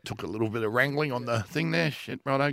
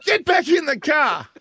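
mock order; 12 semitones higher, 5 dB louder than usual. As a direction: bark it like a fed-up parent. Ordering mate back into car.

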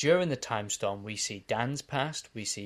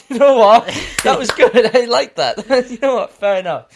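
high, very loud burst of amusement; cracking up, loudly approving; thrilled, much higher and louder; delighted approval; 12 semitones higher, 18 dB louder than usual. Real, not acted. Delighted reaction to the accent attempt.

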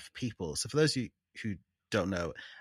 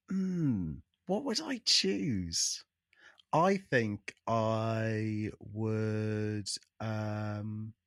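thinking hmm; much slower than usual. Real, not acted. Pondering the question.

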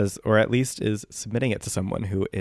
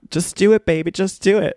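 high scream; 9 semitones higher, 5 dB louder than usual. Retold or imitated. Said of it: "her screaming".

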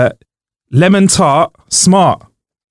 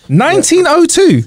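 disbelieving; 13 semitones higher than usual. Real, not acted. Repeats answer in disbelief.

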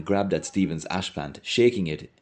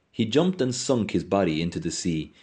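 ordinary delivery

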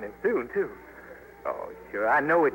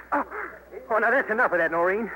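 firm, raised voice to stop her; stern warning; 7 semitones higher than usual. Acted. Men fighting over Noreen.

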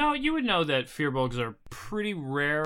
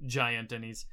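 ordinary delivery

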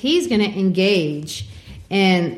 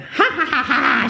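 high teasing laugh; mocking child laugh; 8 semitones higher than usual. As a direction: laugh at him like a little girl. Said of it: "She laughs at him".

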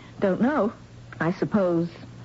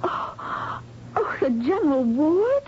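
dismayed cry; 8 semitones higher, slower than usual. Acted. Dismayed at patient's transfer.

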